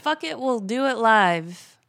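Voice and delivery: slow, loud proclamation of the catchphrase; catchphrase shout. Acted. Cohost echoing the segment catchphrase, slow loud proclamation. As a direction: bellow it like a battle cry.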